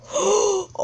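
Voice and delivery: high startled gasp; snatch a quick, high breath; sharp gasp. Real, not acted. Gamer reacting to the match, sharp gasp.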